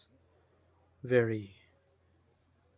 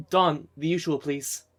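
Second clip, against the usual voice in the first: loud, higher call to the bartender; calling out an order; 4 semitones higher, 7 dB louder than usual. Acted. Ordering the usual from Dawn, calling out.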